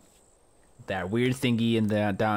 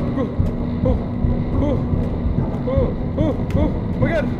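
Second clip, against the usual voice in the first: real panic, screamed far higher and louder; terrified screaming; 10 semitones higher, 7 dB louder than usual. Real, not acted. Player chased by a horror game creature, screaming in terror. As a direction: shriek for your life as it chases you.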